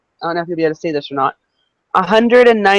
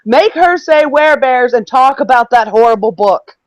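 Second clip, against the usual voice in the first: raised reading-out voice; all caps shouting; 6 semitones higher, 3 dB louder than usual. Retold or imitated. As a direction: read it like it's in capitals. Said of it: "this is all in caps".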